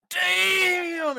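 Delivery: high, loud, drawn-out mock shout; imitated wowed boy. Retelling the boy looking at his crush, hammed-up shout of awe. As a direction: cry it out as the smitten boy, stretched and high. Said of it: "He's looking at her like".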